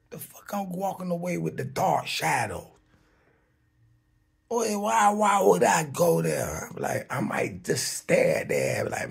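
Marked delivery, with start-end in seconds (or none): 0.1-6.7 high, loud disbelief; indignant refusal. Acted. Refusing to follow the dark shadows, indignant and high. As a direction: balk at being told to go, voice jumping up.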